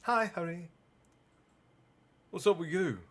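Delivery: a hint of a grumpy younger sister; lightly voiced girl. Reader voicing characters.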